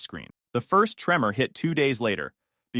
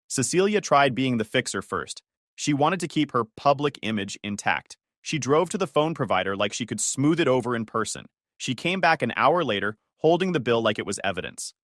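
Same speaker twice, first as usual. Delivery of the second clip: usual speaking voice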